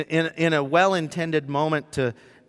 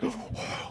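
imitated crowd gasp; 13 dB quieter than usual. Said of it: "everybody in the room's like".

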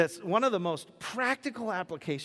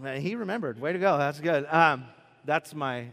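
ordinary talk

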